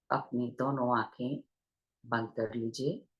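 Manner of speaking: unhurried meditation guidance with long pauses; guided meditation. Real, not acted. Teacher leading online listeners into breath awareness, guided meditation voice. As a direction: guide listeners gently into their breath, leaving long silences.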